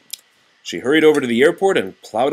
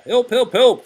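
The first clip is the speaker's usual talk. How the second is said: high, louder triple cry for help; calling for help; 13 semitones higher, 6 dB louder than usual. Acted. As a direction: shout it out like the fire chief. Fire chief calls for help.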